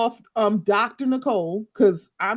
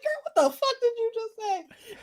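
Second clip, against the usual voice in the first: high, sharp "what did you say"; imitating Lisa, high and appalled; mock incredulous snap; 13 semitones higher, 4 dB quieter than usual. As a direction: throw it back at her, appalled. Imagined Lisa snapping at Alexia.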